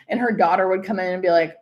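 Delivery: ordinary talk, nothing marked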